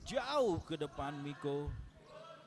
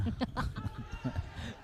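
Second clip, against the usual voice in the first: breathy laugh; 7 semitones higher, 5 dB quieter than usual. Real, not acted. Laughs at even score.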